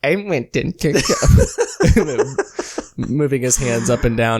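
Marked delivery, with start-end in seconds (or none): 0.0-2.3 very slow, laughing mock Churchill; imitating the bit. Copying his cohost's Churchill bit, laughing. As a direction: drag it out through a laugh. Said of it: "I'm doing the Family Guy bit".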